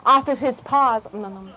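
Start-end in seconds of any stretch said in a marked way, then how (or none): 1.1-1.5 soft munching "mm, mm, mm"; hushed eating noises; mouse nibbling. Acted. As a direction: nibble quietly with little hums.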